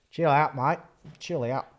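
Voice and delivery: a laid-back mock Australian; Aussie accent. Painter telling viewers to relax, in a mock Aussie accent. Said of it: "stop doing the Aussie accent, [name]".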